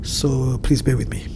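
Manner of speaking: ordinary talk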